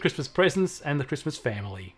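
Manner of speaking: ordinary delivery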